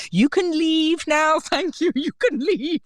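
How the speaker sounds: high, far-off mystic voice dismissing the spirit; hammed-up seer shooing a ghost away; Trelawney. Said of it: "some Trelawney Harry Potter moment".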